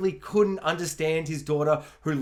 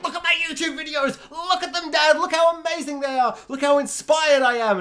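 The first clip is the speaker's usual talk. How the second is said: put-on much higher, fast, eager girl's voice; teenage daughter; 11 semitones higher, 4 dB louder than usual. Daughter showing her dad her YouTube videos, high and eager. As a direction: gush like a kid begging for approval.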